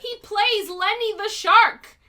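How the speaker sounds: high, loud, drawn-out protest; indignant outburst. Real, not acted. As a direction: correct them in exasperated disbelief. Indignant correction of partner's forgetting.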